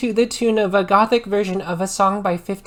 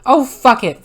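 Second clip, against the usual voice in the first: louder, higher angry curse; angry shout; 4 semitones higher, 7 dB louder than usual. A narrator's reading. Vampire cursing over the news, angry and loud. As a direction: spit it out angrily. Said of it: "Vampire shouted angrily".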